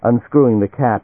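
usual speaking voice

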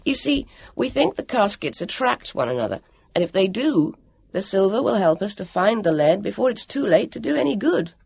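put-on high, earnest boy explaining; urgent Jonathan. Jonathan explaining how caskets attract, urgent boy voice. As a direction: press the point eagerly.

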